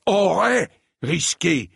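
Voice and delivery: high, indignant bellow; bawling. A narrator's reading. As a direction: bawl the words back in outrage. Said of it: "brailla sir Eustace". (braillement indigné)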